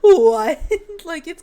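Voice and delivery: loud, high burst of real laughter; incredulous giggling, voice jumping up; laughing. Real, not acted. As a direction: crack up between the words. Cracks up at internship idea.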